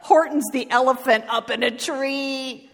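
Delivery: high, loud jeering call; gleeful shout. Acted. Friends taunt Horton in the tree, gleeful shouting. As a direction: shout it out with mocking glee. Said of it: "they shouted with glee".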